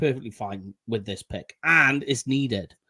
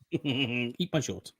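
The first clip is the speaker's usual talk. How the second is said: Bart Simpson line; 6 dB quieter, much slower than usual. Retold or imitated. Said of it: "our very own Bart Simpson".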